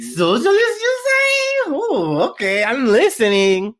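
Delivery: put-on high, loud, hammy German accent; bad German accent. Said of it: "my German accent was very bad".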